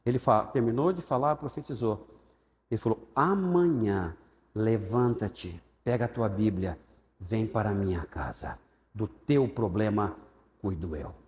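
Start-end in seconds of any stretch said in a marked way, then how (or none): 3.1-11.1 solemn prophecy. Retold or imitated. Pastor's slow prophetic word.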